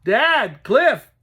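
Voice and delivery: high, loud panicked call; boy yelling in panic for dad and brother. Acted. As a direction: yell for them in panic. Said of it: "Toby yelled with panic".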